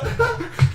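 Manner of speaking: high, loud mock scream; short startled cry of a fleeing guard; frightened yelp. Fleeing guard cries out dropping lantern.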